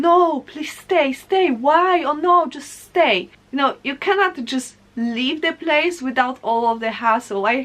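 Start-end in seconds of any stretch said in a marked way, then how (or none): 0.0-3.3 acted begging, higher and drawn out; insistent pleading. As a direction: plead with a friend to stay. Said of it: "supposed to insist on this person".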